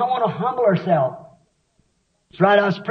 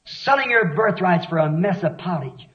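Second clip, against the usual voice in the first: ordinary talk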